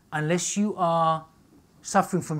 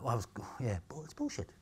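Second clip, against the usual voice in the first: usual speaking voice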